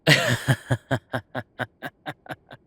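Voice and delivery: a big, bright burst of laughter; loud laugh. Real, not acted. Laughing loudly to partner at own joke. Partner jokes about vampire werewolf fun.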